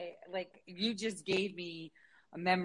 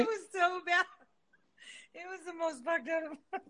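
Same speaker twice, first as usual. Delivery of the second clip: high-pitched, laughing through the words; helpless laughter while telling the story; real laughing; 6 semitones higher than usual. Real, not acted. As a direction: barely get it out for laughing. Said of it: "I'm sorry, I'm laughing".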